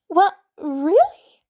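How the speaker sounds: put-on a boy who can't believe it, voice jumping up; lightly voiced surprised kid. Boy hearing the jacket is real, surprised. Peter in disbelief.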